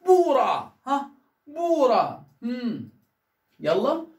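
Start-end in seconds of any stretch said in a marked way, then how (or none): 0.1-0.7 بورا louder, first "oo" held, "ra" quick; long first syllable. Shown as a demonstration. Teacher shows where the madd falls, بورا long then short. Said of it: "نمد الأولانية". 1.5-2.3 بورا with "boo" drawn out and "ra" short; long first syllable. Shown as a demonstration. Teacher repeats the word for pupils, بورا long then short. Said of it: "نمد الأولانية".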